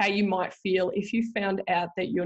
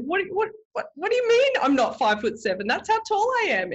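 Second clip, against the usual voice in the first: high, louder incredulous protest; indignant disbelief; 12 semitones higher, 4 dB louder than usual. Retold or imitated. Wife protesting to laughing husband, high mock outrage.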